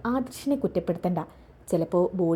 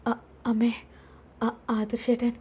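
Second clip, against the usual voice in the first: faint weak whisper; 3 semitones higher than usual. Acted. Daughter wakes weak in hospital.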